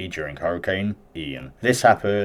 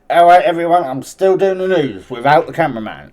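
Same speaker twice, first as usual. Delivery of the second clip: higher, louder mock reporter announcement with a laugh; hammed-up news reporter; 9 semitones higher, 9 dB louder than usual. Imitating the reporter carrying on without his cameraman, loud and mocking. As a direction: ham it up as the reporter soldiering on alone.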